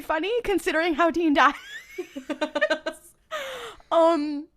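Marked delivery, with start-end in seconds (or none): 1.6-2.9 high burst of laughter. Real, not acted. Podcaster recalling how Dean dies, laughing. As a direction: break into real laughter.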